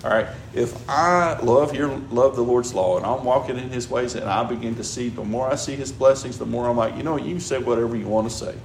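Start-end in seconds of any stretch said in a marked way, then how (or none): none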